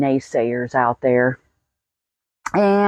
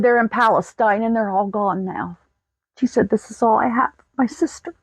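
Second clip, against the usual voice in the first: holding back tears; 5 semitones higher than usual. Real, not acted. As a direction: voice close to breaking, pitched up with emotion. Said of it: "through this without crying".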